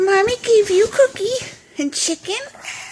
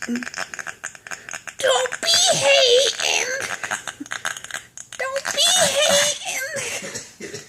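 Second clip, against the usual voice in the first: high, drawn-out playful whine; mock scolding; 8 semitones higher, much slower than usual. Owner telling her dog to share, mock scolding. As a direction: stretch it out high, teasing.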